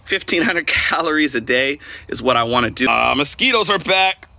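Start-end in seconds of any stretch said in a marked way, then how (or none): none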